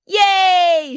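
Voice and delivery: very high, loud cheer; excited shriek. Acted. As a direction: squeal it like a little girl jumping on a rock. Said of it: "shrieked Bridget".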